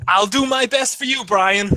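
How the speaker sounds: put-on American accent. Shown as a demonstration. Irish guest promising the host, enthusiastic American accent. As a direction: lay on a peppy American accent. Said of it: "Do that for the rest of the show".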